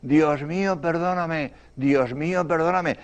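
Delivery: soft, far-off prayer from the wrecked car; a weak voice barely heard; hushed prayer from the dying; faint voice. Retold or imitated. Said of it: "oí una voz que decía".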